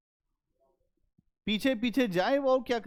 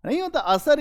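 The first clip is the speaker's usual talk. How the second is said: high, scoffing objection; mock doubting student; 10 semitones higher, 4 dB louder, faster than usual. Teacher voices a student doubting the effect, high and scoffing. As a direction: toss it up high like a sceptic.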